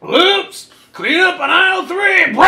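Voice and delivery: high, loud mocking slur; hammed-up high drunk voice; drunk guy. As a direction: sing it out like a careless drunk. Drunk guy spilling beers.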